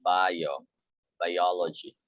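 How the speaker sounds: biology with the stress on the second syllable, ah-LO; teacher modelling biology; stressed bio. Shown as a demonstration. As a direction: say biology slowly as bye-AH-lo-gy. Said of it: "It should be bio".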